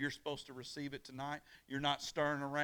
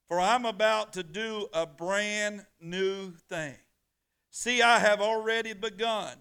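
full-force preaching; 5 semitones higher, 10 dB louder than usual. Acted. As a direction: thunder the verse out to the church.

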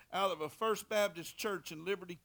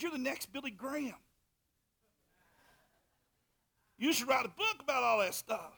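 high, loud quote of an excited neighbour; imitated enthusiastic praise; 7 semitones higher, 7 dB louder than usual. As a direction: call it out like an impressed neighbour leaving. Acts neighbour's enthusiastic parting praise.

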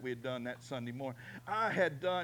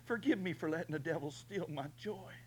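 tearful prayer; 3 semitones higher, 7 dB quieter than usual. Retold or imitated. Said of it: "I said".

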